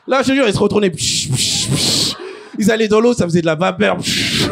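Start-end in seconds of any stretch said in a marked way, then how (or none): 0.9-2.1 short repeated hissing bursts; quick sizzle sound effects; sizzling noise. Retold or imitated. Imitates sizzle as they turn over. 4.0-4.5 sharp steam sound effect; steam noise. Retold or imitated. Imitates steam on entering water.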